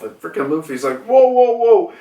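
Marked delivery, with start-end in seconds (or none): 1.0-1.9 taken-aback protest. Retold or imitated. Luffy protests the scolding.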